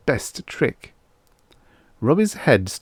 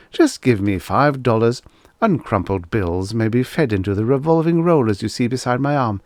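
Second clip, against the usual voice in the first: higher, louder pushy sales patter; robot salesman pitch; 4 semitones higher, 3 dB louder than usual. Acted. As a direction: sell it like a showman robot.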